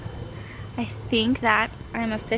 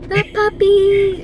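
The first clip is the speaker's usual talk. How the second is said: excited; 8 semitones higher, 7 dB louder, slower than usual. Real, not acted. Excited about puppy.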